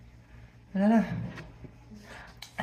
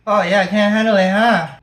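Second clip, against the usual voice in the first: loud mocking; 8 dB louder than usual. Real, not acted. Mocking friend loudly.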